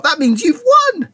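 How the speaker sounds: very high, loud jubilant cry; thrilled, top-of-the-voice announcement; excited cry. A narrator's reading. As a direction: burst out with the great news. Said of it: "he cried out".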